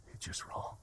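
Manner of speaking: barely audible whisper; whispered aside. Acted. Preacher pressing guilt on the congregation, whispered. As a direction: drop to a hushed whisper.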